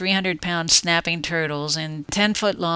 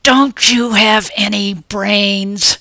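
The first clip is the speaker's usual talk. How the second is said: slow, louder angry snarl with stress on Don't; furious hiss; 3 semitones higher, 7 dB louder, much slower than usual. Acted. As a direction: snarl the question, punching Don't. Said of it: "I hissed at him furiously".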